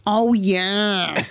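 put-on half-hearted Boston accent; badly faked Boston drawn-out greeting. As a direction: have a reluctant go at a Boston accent. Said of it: "try a Boston accent".

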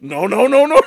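loud, high teasing imitation; rapid repeated objection, cracking up; mock protest. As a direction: laughingly mimic someone backpedaling. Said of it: "and you're like".

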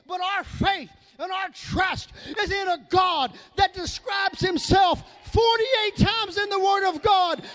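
impassioned shouted sermon, driving phrase by phrase; rousing pulpit cry; full-force preaching. Real, not acted. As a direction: preach it hard, leaning on each key word.

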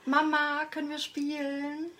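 put-on high, eager little girl asking to play; child voice. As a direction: play the small daughter begging. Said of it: "das Kind, was [name] jetzt spielt".

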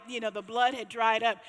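ordinary delivery

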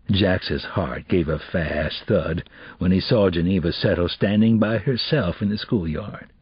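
plain reading voice

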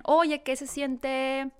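lightly mock interviewer, very slow; slow drawn-out interviewer question. Reporter asking Christoph Waltz, slow mock drawl. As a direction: stretch it out like a cheesy interviewer. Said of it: "vi una entrevista donde le preguntaban".

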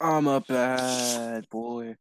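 very slow, put-on tough voice; slow bad boy voice. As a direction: drawl it like a villain. Joking character voice.

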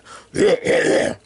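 throaty rattling creature clicks; Predator impersonation. Shown as a demonstration. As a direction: rattle and click like an alien hunter. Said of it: "This is my Predator impersonation".

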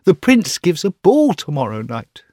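a lovesick student dreaming aloud; young student voice. Acted. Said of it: "murmured the young student".

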